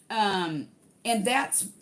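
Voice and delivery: ordinary delivery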